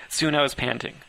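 ordinary delivery, nothing marked

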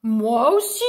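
loud, growled dragon reply; rumbling dragon. A narrator's reading. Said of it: "gronda une voix tout près".

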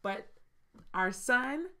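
ordinary talk

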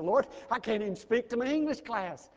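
high, strained protest; pleading with God. Retold or imitated. Young preacher begging God to choose someone else, high and pleading. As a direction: plead your inability, voice lifted. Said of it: "And I said".

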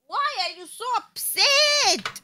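coaxing whine. Real, not acted. Partner asking the annoyed streamer what is wrong, plaintive whine.

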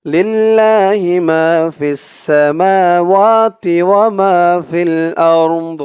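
slow, chanted tajweed recitation; drawn-out Quranic chant. Shown as a demonstration. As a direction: recite it slowly in melodic tajweed. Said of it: "আমার সাথে বলুন".

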